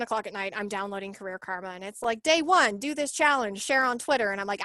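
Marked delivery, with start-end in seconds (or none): 2.2-4.3 higher, louder mock-chirpy orders; pushy app prompt. Retelling the app's day one challenge, mock-pushy. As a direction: rattle off the app's demands, pitched up.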